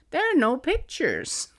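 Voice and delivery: put-on high little-boy verdict; toddler pronouncement. Mom imitating her son judging the New Testament, high child voice. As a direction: announce it like a disappointed two-year-old. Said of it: "very soon pronounced".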